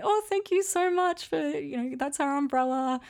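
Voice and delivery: high-pitched impression of grateful girls; giggling, grateful girls. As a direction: imitate gushing thanks in a high put-on voice. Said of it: "giggling and very grateful".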